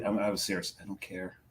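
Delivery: ordinary talk, nothing marked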